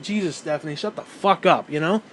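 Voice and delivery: ordinary delivery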